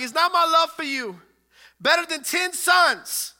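high, very loud mock pleading; hammed-up shout. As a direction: yell the husband's question, stretching the end. Repeats husband's question at full volume.

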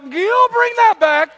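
hammed-up wish to undo the words; desperate mock plea. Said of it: "you're like".

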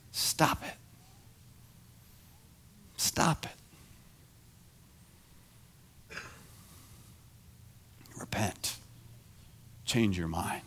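gentle whisper, slow pauses; Jesus whispering correction; whispered rebuke. Acted. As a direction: hush him firmly but softly. Voicing Jesus correcting him.

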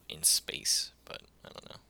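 whisper. Real, not acted. Trails into whisper.

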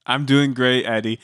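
ordinary delivery

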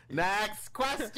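high, stretched-out host call; mock game-show call, very slow; drawn-out announcement. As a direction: sing out each word to change the subject. Host cuts off topic, moves on.

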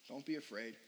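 Jesus quietly reassuring his frightened disciples, calm; calm reassurance. Retold or imitated. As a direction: soothe frightened friends gently.

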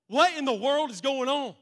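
astonished; loud, high baffled outcry. Acted. Visitor asking owner about the dogs, loud and baffled.

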